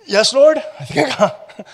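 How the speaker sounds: high, loud eager disciple; eager Peter. Acted. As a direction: answer like a flustered Peter.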